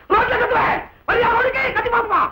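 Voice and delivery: high-pitched angry yell; defiant shouting. Acted. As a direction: shout a challenge to come outside. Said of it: "ஏய்யா கத்துற?".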